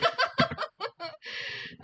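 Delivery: bright high-pitched giggle. Real, not acted. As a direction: giggle at yourself, embarrassed. Laughs at own pronunciation.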